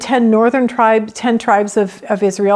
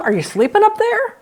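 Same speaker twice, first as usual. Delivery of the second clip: much higher, disbelieving question upward; incredulous high question; 5 semitones higher than usual. Retold or imitated. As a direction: ask if God is really asleep. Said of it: "You're opening the curtain saying".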